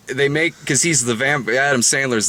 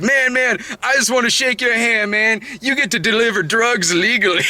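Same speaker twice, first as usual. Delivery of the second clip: excited stranger; 10 semitones higher than usual. Retold or imitated. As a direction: gush like an awed stranger. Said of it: "This guy comes up to me and goes like".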